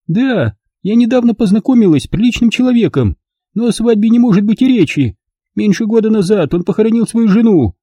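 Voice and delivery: put-on high, proud elderly noblewoman; old lady voice. A narrator's reading. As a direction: speak as a prim dignified old lady.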